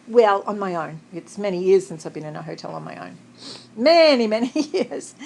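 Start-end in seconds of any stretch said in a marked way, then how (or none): 3.8-4.3 louder "Many", higher and louder; emphatic. Real, not acted. Exaggerating how many years. 4.3-5.1 laughing. Real, not acted. Laughing at her age.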